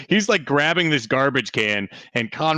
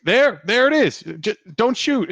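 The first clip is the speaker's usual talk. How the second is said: high, frightened plea; pleading; 9 semitones higher than usual. Acted. Worker at gunpoint pleading, high and frightened. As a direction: beg not to be shot.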